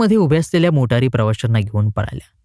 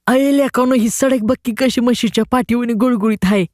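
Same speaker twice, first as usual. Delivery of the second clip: put-on much higher, squeaky boy's voice; 9 semitones higher than usual. A narrator's reading. The boy tells his friends about the smooth road in a squeaky voice. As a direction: squeak it out in wonder. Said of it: "चिरक्या आवाजात म्हणाला".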